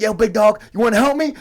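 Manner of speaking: excited retold shout; calling out. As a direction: hail a buddy across the street. Voicing looter calling to him.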